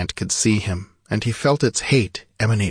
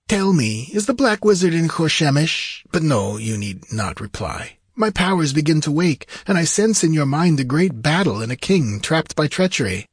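high, intent theatrical voice; high-voiced wizard, portentous; sorcerer regaining his powers; 7 semitones higher than usual. A narrator's reading. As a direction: speak high, as if sensing a vision.

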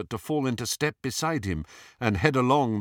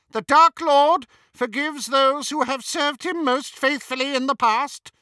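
high, loud, contemptuous witch; haughty scorn, pitched far up and ringing out; Bellatrix; 7 dB louder than usual. A narrator's reading. Hermione posing as a Death Eater, contemptuous imitation. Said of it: "magnificent imitation of Bellatrix's most contemptuous manner".

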